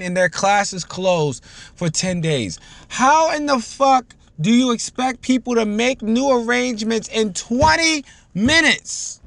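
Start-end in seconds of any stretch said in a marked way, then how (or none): 2.9-9.2 angry rant. Real, not acted. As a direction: hammer the disbelief. Ranting about school closures.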